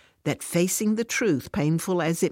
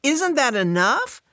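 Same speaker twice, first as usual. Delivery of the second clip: much higher and louder, indignant; raised voice; 10 semitones higher, 4 dB louder than usual. Acted. Fran to Alice, raising her voice in indignation. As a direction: snap back at the question. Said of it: "Fran raises her voice".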